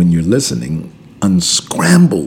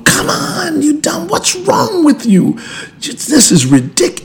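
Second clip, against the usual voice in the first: mock road rage. Friend yelling at another driver mid-call, high angry outburst. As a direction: blow up at the other driver. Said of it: "they start yelling at the other person driving".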